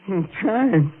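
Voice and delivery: hollow laughing repeat; bitter laugh. Acted. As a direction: laugh the words out in disbelief. Bitter hysterical laugh at fate.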